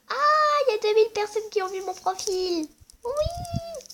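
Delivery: excited shriek of joy; thrilled. Real, not acted. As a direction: squeal with delight at the number. Thrilled by profile view count.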